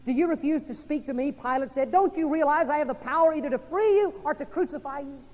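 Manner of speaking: loud, high preaching of Pilate's threat; booming pulpit retelling; preaching at full force. Acted. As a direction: thunder Pilate's words at the crowd. Pilate quoted at pulpit volume.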